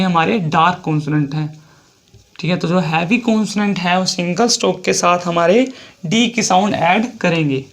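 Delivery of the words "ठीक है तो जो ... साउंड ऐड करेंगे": ordinary talk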